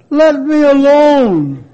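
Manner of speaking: loud cry of protest; yelled out. A narrator's reading. Clay teacup yelling at its master to stop. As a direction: shout it, begging to be left alone. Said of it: "and I yelled out".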